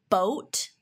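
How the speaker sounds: "boat" with a long rounded Minnesotan o; Minnesota accent. Shown as a demonstration. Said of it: "certain words that I can't say without a Minnesotan accent".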